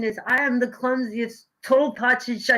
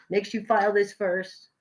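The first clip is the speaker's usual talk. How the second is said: usual speaking voice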